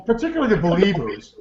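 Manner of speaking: normal talking voice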